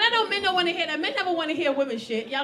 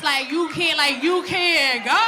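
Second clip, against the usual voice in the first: loud, confrontational mom yelling; mock confrontation; 6 dB louder, much slower than usual. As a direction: get in her face, loud. Mom confronting teen daughter.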